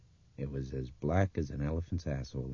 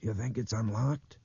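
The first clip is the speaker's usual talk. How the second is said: higher-pitched question, rising at the end; questioning character voice; 7 semitones higher than usual. A narrator's reading. Boy at a closed door asking, higher questioning voice. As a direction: ask it high and unsure.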